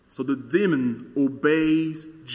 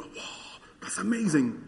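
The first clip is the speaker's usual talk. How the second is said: hushed whisper of wonder; mock awed whisper; whispered amazement; 3 semitones higher, 6 dB quieter than usual. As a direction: breathe it out in awe. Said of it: "just go".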